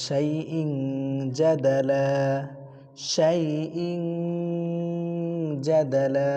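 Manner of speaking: শাইইং with a long hidden nasal; শাইইং held in the nose, then জাদালা; ikhfa recitation. Shown as a demonstration. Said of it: "গুণনা কি অস্পষ্ট হবে". (শাইইং নাকে গুন্নাহ দিয়ে টেনে)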